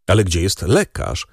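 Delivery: ordinary delivery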